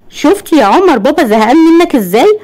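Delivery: high playful mother's voice; أم بتكلم ابنها. A narrator's reading. Mother teases talking to child. (صوت أم عالي بتهزر مع ابنها)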